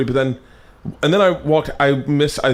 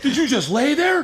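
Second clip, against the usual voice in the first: mock victim blaming; 13 semitones higher than usual. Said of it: "victim blame".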